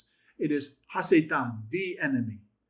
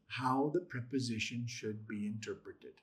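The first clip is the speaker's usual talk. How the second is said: whisper; 5 semitones lower, 10 dB quieter than usual. Real, not acted. Teacher trailing into whisper.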